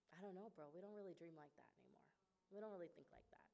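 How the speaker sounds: very soft, deflated reply; hushed confession, losing voice toward the end; subdued admission. Retold or imitated. Retold dejected answer to big question.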